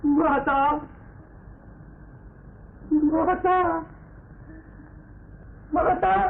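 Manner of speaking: very high, desperate calls; anguished cry. Acted. Bereft father crying out to the Mother, anguished. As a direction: cry out to the goddess in despair.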